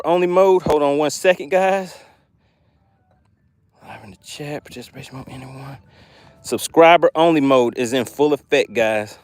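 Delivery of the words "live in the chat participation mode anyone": much softer muttered aside; quiet aside. Real, not acted. Streamer fiddling with chat settings, muttering quietly. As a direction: mumble it to yourself.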